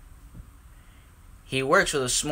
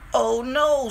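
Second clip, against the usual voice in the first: dismayed; 10 semitones higher than usual. A narrator's reading. The special pipe fell off.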